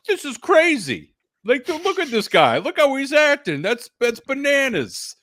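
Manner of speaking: exaggerated incredulous mimicry; mock amazement. Mimicking amazed wrestling spectators.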